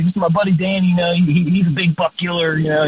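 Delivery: a hint of a buddy introducing a friend; excited buddy. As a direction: mildly hype up a friend's hunting skill. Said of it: "he hollers".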